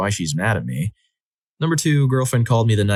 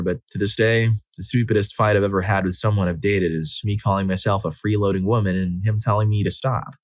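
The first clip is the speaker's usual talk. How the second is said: ordinary delivery, nothing marked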